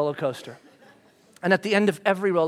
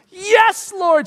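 joyful cry; 11 semitones higher, 6 dB louder than usual. Acted. Quoting worshipful cry.

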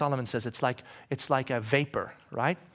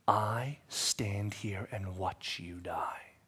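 hushed and very slow; slow whisper; 6 semitones lower, 7 dB quieter, much slower than usual. Acted. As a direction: let it hang in a whisper. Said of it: "Jesus is saying to us".

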